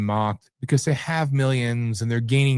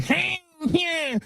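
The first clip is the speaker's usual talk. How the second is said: high pinched mock voice, squeezed through the nose; mocking Nick Fuentes. Vlogger mocking Fuentes to his viewers, high nasal parody. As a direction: squeak it out like a whiny pinched-nose kid. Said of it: "His nasally little voice".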